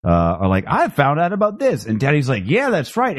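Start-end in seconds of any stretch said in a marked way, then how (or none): 0.7-1.8 much higher, eager mock guest voice; excited guest. Guests excitedly sharing a find. 2.5-3.2 much higher, breezy know-it-all voice; mock Teddy. Mocking Teddy's casual know-it-all reply.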